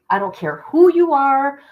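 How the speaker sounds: loud, hard "I don't care who you are"; mama bear warning her off; fierce defiance. Real, not acted. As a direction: protective mother bear snapping back. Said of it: "I become a mama bear".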